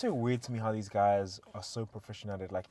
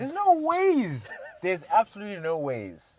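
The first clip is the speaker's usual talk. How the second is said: high, drawn-out, loud disbelief; stretched high-pitched astonishment; amazed disbelief; 10 semitones higher, 6 dB louder, slower than usual. Real, not acted. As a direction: exclaim it like you can't believe it. Disbelief on learning he is Irish.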